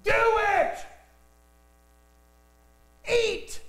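hunger's shout; screamed urge. Retold or imitated. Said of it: "was screaming".

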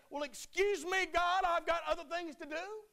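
high, loud, brushing God off; mock dismissive excuse. Preacher mimicking a lukewarm believer to God, mocking sarcasm. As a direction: shrug God away in a sarcastic voice. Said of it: "You want to say".

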